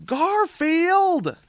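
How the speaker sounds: high, loud scolding yell; exasperated owner yell; scolding shout. Acted. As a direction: call the cat out sharply. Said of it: "says John".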